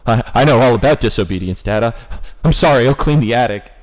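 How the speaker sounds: put-on loud, panicky kid apologizing; loud frantic apology. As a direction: blurt it out in a scared rush. Frightened kid apologizing to dad.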